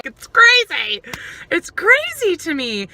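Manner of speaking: can't believe it's real; exasperated disbelief. Real, not acted. Exasperated at herself.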